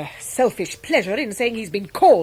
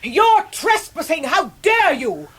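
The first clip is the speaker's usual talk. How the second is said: furious shout; 8 semitones higher, slower than usual. Acted. Aunt shouting at the donkey boys on her turf, outraged yell. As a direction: bellow at the donkeys like your lawn is sacred.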